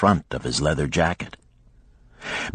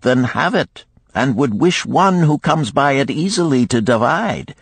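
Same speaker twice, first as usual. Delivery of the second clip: lightly voiced lender, a little higher-pitched; advising gold lender; 11 semitones higher, 3 dB louder than usual. Lender challenges his guest's will, mildly animated. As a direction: counsel with animated, gentle challenge.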